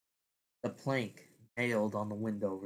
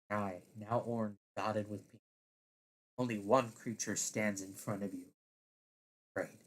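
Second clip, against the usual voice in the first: ordinary delivery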